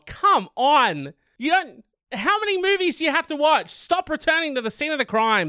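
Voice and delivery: high-pitched incredulous scolding; mock exasperation. Host scolding thief for returning, exasperated. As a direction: scold with rising disbelief.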